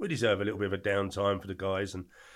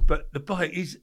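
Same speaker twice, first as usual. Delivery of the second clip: usual speaking voice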